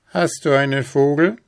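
gehobene, laute, fordernde Teufelsstimme; rufender Teufel. A narrator's reading. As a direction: herausfordernd rufen. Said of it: "rief er dem Manne zu". (fordernd rufender Teufel)

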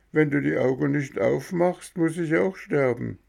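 high, wailing plea to a hurt friend; wailing lament. A narrator's reading. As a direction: cry out to him, begging him to wake. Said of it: "weinte, jammerte und rief dem Armen beständig".

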